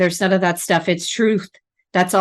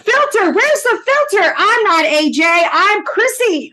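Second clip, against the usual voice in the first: much higher, laughing disbelief; high mock-outraged cry; mock outrage; 11 semitones higher than usual. Daughter scolding her father's remark, high and outraged.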